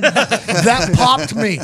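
lightly put-on voice, slow and tickled; Michael Cole. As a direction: stretch it out as an amused boss. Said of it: "I hear Michael Cole go".

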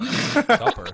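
loud, high-pitched astonished question; real incredulity. Real, not acted. Co-host balks at an unfamiliar word, loudly incredulous.

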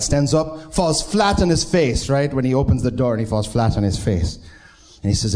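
ordinary delivery

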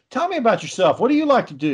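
high, eager recruiter voice pushing the cult; Tom Cruise impression. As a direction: pitch it up like an overly keen salesman. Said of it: "He'd be like".

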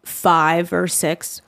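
ordinary delivery

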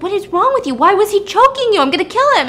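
furious outburst. Retold or imitated. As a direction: shout it like you're ready to go after him. Husband sees wife choked.

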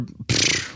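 voiceless puff through the lips; pfft. Real, not acted. Podcast host plugging a guest to listeners, breathy pfft. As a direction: blow a short, dismissive pfft. Imitated noise in podcast plug.

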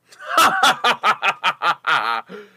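loud, high staccato cackle; obnoxious laugh. Acted. Someone erupting from the crowd, obnoxious laugh. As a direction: burst into a long, forced, obnoxious guffaw. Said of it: "obnoxious laugh erupting from the crowd".

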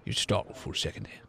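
low, hushed nervous recovery; startled. Acted. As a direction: catch your breath, startled. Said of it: "gets scared, looks around".

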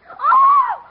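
high, loud gasp of shock; shocked shriek. Acted. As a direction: shriek at the mess. Niece shocked at room.

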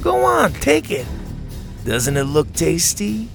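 high, sing-song fake coaxing; coaxing taunt. A narrator's reading. Ash offers Buck a poisoned frog, coaxing. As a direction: tempt a pet with false sweetness.

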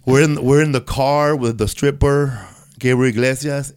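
ordinary delivery

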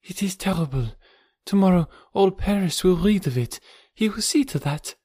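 very high, distressed woman's voice; frightened. A narrator's reading. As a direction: fret in a high, helpless voice. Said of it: "She was very frightened".

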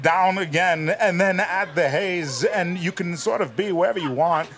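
Christopher Walken. Shown as a demonstration. Guest's Walken tutorial done in the voice, hazy swooping impression. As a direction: keep lifting where the period should be. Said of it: "you do this great walking".

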